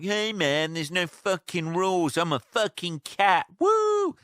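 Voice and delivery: high, cocky party-animal cat ending in a whoop; cat brushes off the neighbour's complaints, cocky high whoop; carefree cat. Pretend. Cat voiced as a carefree rebel.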